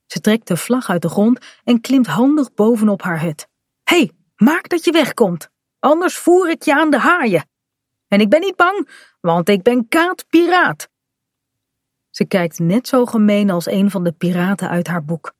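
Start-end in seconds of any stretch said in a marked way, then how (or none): 3.8-10.9 high mock-fierce pirate bluster; pirate threat. Kaat on her hut chasing the gulls off as a pirate, high mock threat. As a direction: play the fearless pirate scaring them off.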